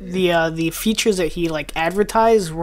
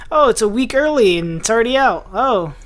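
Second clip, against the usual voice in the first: higher, louder put-on pleasant surprise; mock announcement; 5 semitones higher, 4 dB louder than usual. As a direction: imitate a developer casually springing an early release. Acts out developer's early release announcement.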